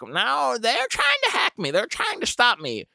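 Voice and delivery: put-on high old lady voice, slowing at the end; indignant about hackers; aggrieved old neighbor. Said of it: "she's like".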